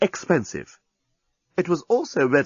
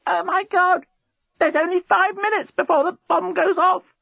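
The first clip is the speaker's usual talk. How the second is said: much higher, far voice in alarm; alarmed old Nettie. Acted. Old Nettie to Dan, sitting up in sudden alarm. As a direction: warn them in a sudden panic.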